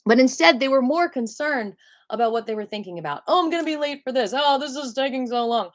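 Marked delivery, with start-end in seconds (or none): 3.3-5.7 impatient shopper. Retold or imitated.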